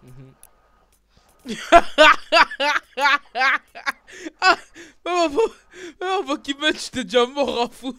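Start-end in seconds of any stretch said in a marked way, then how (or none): 1.6-3.9 loud laugh. Real, not acted. Laugh burst.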